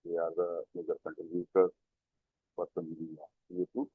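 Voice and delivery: faint, fading, far from the mic; trailing off into near silence; voice very low. Real, not acted. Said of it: "your voice is very low".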